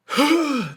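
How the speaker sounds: one sudden high gasp, like being hit; sharp gasp. Retold or imitated. Knocked out by a shot to the helmet, a sharp gasp. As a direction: gasp in loud and high, then let it go. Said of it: "this, like, profound exhale".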